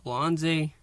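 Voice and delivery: normal talking voice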